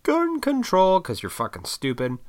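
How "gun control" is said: very high-pitched mock cry; mocking outcry. Mimicking everybody after a shooting, high mocking outcry. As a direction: parrot a crowd's slogan, way up high.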